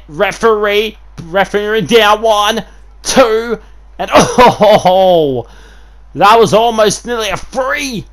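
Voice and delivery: high, loud count with a laugh; excited count. Real, not acted. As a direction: count along, then gasp at the kickout. Ref count, near fall.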